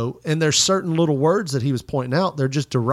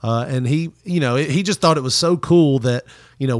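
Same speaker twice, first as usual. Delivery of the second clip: usual speaking voice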